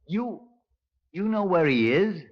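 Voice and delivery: sudden high startled question; surprised. Acted. Inspector startled to hear prisoner knows hideout, high surprised question. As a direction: caught off guard, voice jumping up.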